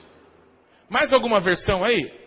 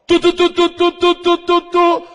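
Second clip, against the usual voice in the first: high, loud, evenly spaced "tu" blasts; voiced shofar call, held on one high pitch; shofar blast imitation; 8 semitones higher, 9 dB louder than usual. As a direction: toot each "tu" like a ram's horn. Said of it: "Assim, ó".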